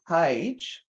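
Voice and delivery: usual speaking voice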